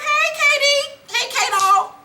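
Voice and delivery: very high sing-song hello; mock friendly greeting. Tasha greeting Kanan at the elevator, mock friendly. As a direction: greet him in a hammed-up high voice.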